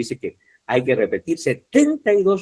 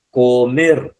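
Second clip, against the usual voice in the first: "Comer" loud, with the force on "mer"; teacher's demo of "Comer", emphasis on "mer"; stress on last syllable; 6 dB louder than usual. Shown as a demonstration. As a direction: push the final syllable of "Comer". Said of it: "¿Dónde está la mayor fuerza de voz?".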